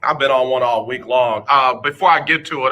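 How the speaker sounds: ordinary talk, nothing marked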